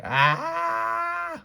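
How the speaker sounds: long, very high "Ah!" scream; play-acted high-pitched scare scream; scary scream. A narrator's reading. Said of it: "I did my scariest screaming".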